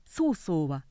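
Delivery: ordinary delivery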